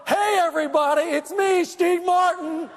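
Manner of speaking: high, drawn-out boastful holler; imitating a show-off yelling for attention; mock yelling. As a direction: announce yourself to the whole lobby. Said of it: "started yelling".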